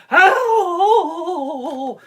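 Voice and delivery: high, loud hammed-up wailing; mock umpire cries. Umpire celebrating strike three, loud high mock wailing. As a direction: wail it like a showboat umpire. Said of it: "the fucking umpire's like".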